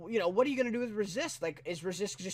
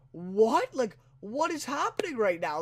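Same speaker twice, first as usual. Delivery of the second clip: high, startled what-is-going-on; stunned, higher pitched disbelief; shocked disbelief; 4 semitones higher, 3 dB louder than usual. Retold or imitated. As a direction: react as if you just saw him shot. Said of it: "And it's like".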